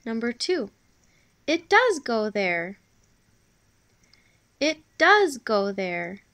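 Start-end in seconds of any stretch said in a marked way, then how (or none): 1.5-2.8 loud, slow exclamation punching "does"; emphatic, drawn-out excited statement. Shown as a demonstration. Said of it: "I had an excited tone there". 4.6-6.2 loud, slow exclamation punching "does"; emphatic, drawn-out excited statement. Shown as a demonstration. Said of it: "I had an excited tone there".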